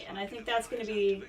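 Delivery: normal talking voice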